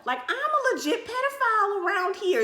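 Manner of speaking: playful scolding; mock outrage. Girlfriend scolding younger boyfriend, high mock outrage. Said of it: "I said".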